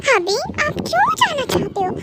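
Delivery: put-on high, childlike, hurt question; plaintive pleading. Kookie begs hubby not to leave, plaintive.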